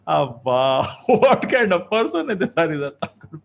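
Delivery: loud, high laughing disbelief; amused amazement. Acted. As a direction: exclaim like astonished townsfolk, laughing. Townspeople amazed, voiced with laughter.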